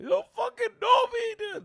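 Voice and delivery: high, loud bratty outburst; hammed-up teen tantrum; mock angry yell. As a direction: yell it like a furious kid at his dad. Angry kid snapping at dad.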